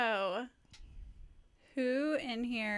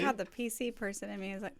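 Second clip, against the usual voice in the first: ordinary talk, nothing marked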